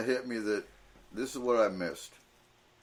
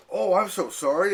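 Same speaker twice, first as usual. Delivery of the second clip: mildly hammed-up retold apology; apologetic; 5 semitones higher, 4 dB louder than usual. Security guard to cast member in bathroom line, apologetic. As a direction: imitate a higher-pitched apology.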